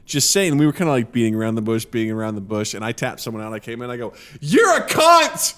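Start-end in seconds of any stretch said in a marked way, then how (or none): 4.4-5.5 high, loud, drawn-out yell; re-enacted aggressive outburst; shouted insult. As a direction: shout it in someone's face. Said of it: "just shouted that in someone's face".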